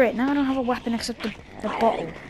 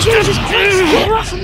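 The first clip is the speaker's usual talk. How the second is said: high, very loud scream to get off; panicked shout; 8 semitones higher, 10 dB louder than usual. Real, not acted. Grabbed by a walker, yelling to get free, panicked shout. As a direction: shriek at the attacker.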